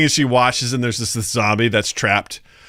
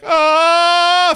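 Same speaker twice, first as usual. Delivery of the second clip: put-on high startled yelp of a panicking anime boy; flustered yelp; 4 dB louder than usual. Retelling a cartoon boy's panic to a co-host, flustered high yelp. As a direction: squeak out a flustered cry, pitch shot up. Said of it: "freaking out".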